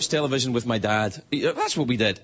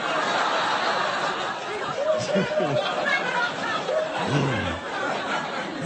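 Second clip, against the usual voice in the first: high laughing fit. Real, not acted. Long laugh after impression.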